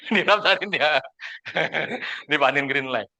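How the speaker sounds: real chuckle through the words; amused laughing. Real, not acted. Speaker amused that the friend got signed up, laughing. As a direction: laugh while saying it.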